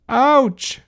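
high, loud yelp of pain; crying out. A narrator's reading. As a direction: yelp as if bumped. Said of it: "cried Alfred".

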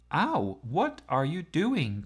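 slow, high yelp of surprise; mild cry. A narrator's reading. Chloe startled by Gordon in the seat swap, yelping. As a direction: yelp it, caught off guard. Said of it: "cried Chloe".

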